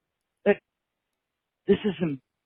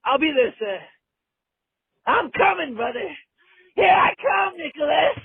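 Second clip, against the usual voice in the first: excited shouting; 10 semitones higher than usual. Acted. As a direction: yell it out like charging in. Caller hypes himself, shouting.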